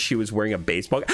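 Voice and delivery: normal talking voice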